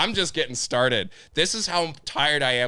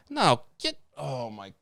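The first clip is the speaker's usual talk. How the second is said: outburst; 3 semitones higher, 3 dB quieter, much slower than usual. Real, not acted. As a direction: voice jumps way up in frustration. Rant boils over.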